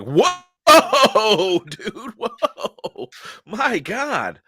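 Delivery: high, drawn-out whoa through laughter; mock amazement. Acting out a startled reaction.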